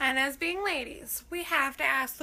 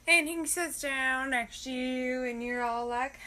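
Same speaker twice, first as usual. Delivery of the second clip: usual speaking voice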